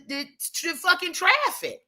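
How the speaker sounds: ordinary talk, nothing marked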